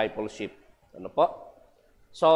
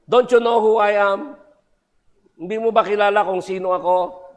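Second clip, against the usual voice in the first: higher and louder as Satan, demanding; 6 semitones higher, 6 dB louder than usual. Acted. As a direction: play the devil confronting the old man. Preacher voices Satan in joke.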